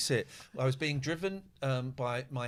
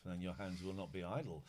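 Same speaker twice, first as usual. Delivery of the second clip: sleazy low murmur; 6 semitones lower, 9 dB quieter than usual. Pretend. Sleazy riff on film line.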